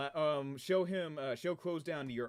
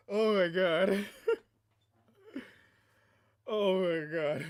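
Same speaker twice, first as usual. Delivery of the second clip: loud, through laughter; laughing; 5 semitones higher, 6 dB louder, much slower than usual. Real, not acted. Streamer still cracking up, loud laughing oh my God. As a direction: blurt it out mid-laugh.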